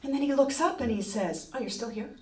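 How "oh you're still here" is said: very soft, unbothered aside; quiet, indifferent remark; offhand boss. Retold or imitated. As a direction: imitate a boss barely bothering to speak.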